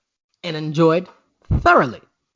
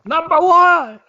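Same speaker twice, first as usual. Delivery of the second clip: announcer shout; 10 semitones higher, 7 dB louder, slower than usual. Acted. Echoing the announcer call.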